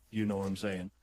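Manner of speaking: ordinary delivery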